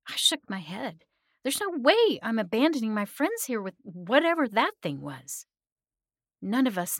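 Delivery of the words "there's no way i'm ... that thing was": defiant refusal. A narrator's reading. Refusing to abandon captive friends.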